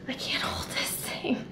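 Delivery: breathy whisper under strain, arms giving out; barely voiced, struggling to hold the camera. Real, not acted.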